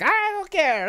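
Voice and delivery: high, hammed-up Greek accent; mock Greek accent. Fan imitating Zeus in a hammed-up Greek accent. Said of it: "how his accent goes".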